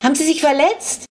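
high, loud call over the hedge; boy calling out in concern. A narrator's reading. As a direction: shout across to the neighbour. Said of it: "ruft Titus".

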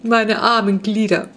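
mock whimper over stiff limbs; whining lament. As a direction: complain like an aching old man. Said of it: "jammerte er".

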